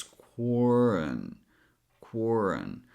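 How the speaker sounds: QUARREN stretched, with R linked lightly into "un"; weak second syllable. Shown as a demonstration. Teacher correcting learners' "run", saying "quarren" with a light linked ending. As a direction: say "quarren" slowly, keeping the last vowel weak. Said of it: "without making it too strong".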